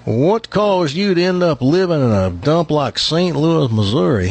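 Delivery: put-on joke-teller's Texas accent. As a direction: play a bragging Texan, drawn out. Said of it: "That's a pretty good Texas accent".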